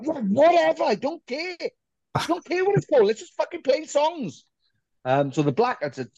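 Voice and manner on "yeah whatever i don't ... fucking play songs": high-pitched, dismissive whine; exaggerated couldn't-care-less, pitched way up; mock impatience. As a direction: brush it off in a squeaky put-on voice. Acting out his careless impatient self.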